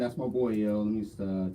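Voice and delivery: ordinary talk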